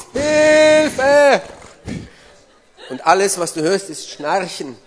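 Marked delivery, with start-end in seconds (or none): 0.1-1.4 very high, loud drawn-out yell; shouted cry for help. Acted. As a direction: scream it out to wake someone asleep. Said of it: "Und du schreist".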